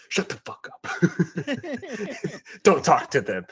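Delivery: a hammed-up commander shutting him down; mock gruff scolding. Space Wolf leader silences the Wolfen soldier, mock angry.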